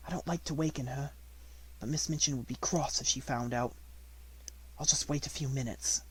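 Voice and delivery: soft hushed voice. Acted. Sarah whispers over sleeping girl.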